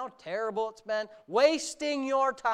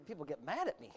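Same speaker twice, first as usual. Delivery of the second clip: quiet aside; 5 semitones lower, 12 dB quieter than usual. Real, not acted. Aside after preaching peak.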